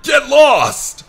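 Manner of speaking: scream. Acted. Young man screaming at a defiant seagull, frustrated and loud. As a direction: scream it at the bird full force. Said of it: "he lets out a scream".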